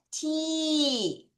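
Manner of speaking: "T" stretched into a long tee; syllable drawn out. Shown as a demonstration. Teacher dictates the next part to pupils, long held "T". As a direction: hold "T" long as a syllable by itself. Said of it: "음절을 잘 끊어야 되죠".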